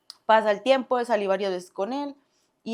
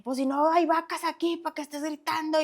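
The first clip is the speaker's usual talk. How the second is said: higher, sharp scolding; scolding mother; 8 semitones higher than usual. Retold or imitated. Mother scolding the yelling boyfriend, lightly voiced. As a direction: scold like an annoyed mom.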